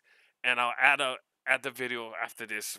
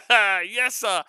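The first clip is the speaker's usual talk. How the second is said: loud, much higher laugh into yes sir; 9 semitones higher, 12 dB louder than usual. Real, not acted. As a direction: crack up and cheer. Laughing at his own joke.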